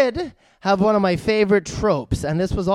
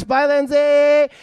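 higher, drawn-out farewell call; calling goodbye; 7 semitones higher, 4 dB louder, much slower than usual. Real, not acted. Host to Lindsay leaving with the dog, calling out. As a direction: call out a long singsong goodbye.

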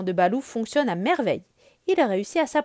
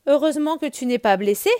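louder, relieved outburst; exclaiming in relief; 4 semitones higher, 7 dB louder than usual. Acted. Mowgli hugging Balou, relieved and loud. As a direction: burst out with relief.

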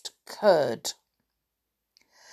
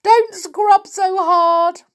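pleading; 13 semitones higher, 8 dB louder, slower than usual. A narrator's reading. Begging Tom.

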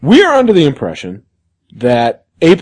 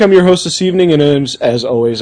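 ordinary talk

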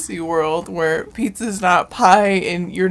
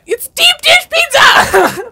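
high, slow mocking shout; 9 dB louder, slower than usual. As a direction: yell it like her. Mocking shout of her claim.

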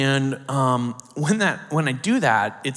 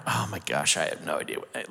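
much lower and softer, at a loss; stunned under-breath; 11 semitones lower, 8 dB quieter than usual. Retold or imitated. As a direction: let it drop away in disbelief. Overwhelmed, at a loss.

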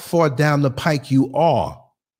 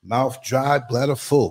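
usual speaking voice

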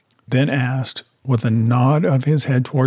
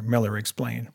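usual speaking voice

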